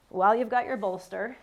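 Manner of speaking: normal talking voice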